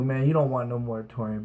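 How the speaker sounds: ordinary talk, nothing marked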